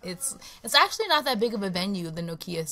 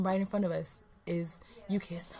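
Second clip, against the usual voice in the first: unvoiced awed whisper; 3 semitones lower, 6 dB quieter than usual. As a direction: hush it in awe.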